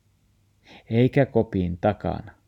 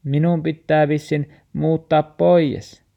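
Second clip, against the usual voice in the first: trembling voice; 8 semitones higher, 6 dB louder than usual. Acted. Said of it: "tärräävällä äänellä".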